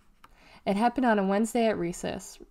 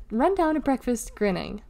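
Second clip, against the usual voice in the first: plain reading voice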